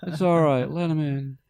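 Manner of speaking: put-on high, loud drunken pleading; hammed-up drunk calling out from the stage; imitating drunk promoter. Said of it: "later on he's really drunk".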